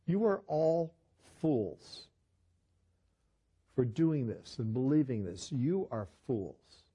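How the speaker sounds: ordinary delivery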